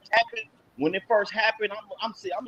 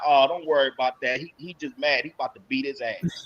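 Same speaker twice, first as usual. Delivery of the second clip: lower, softer, unbothered reassurance; calm cousin; 7 semitones lower, 4 dB quieter than usual. Retold or imitated. Cousin on the phone calming him down, low and unbothered. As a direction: play the cousin shrugging it off. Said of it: "He was like".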